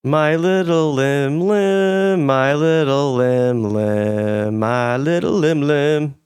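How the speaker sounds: slow, drawn-out cooing to a pet; doting singsong. Real, not acted. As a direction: croon it like cradling a tiny animal. Cooing over new pet.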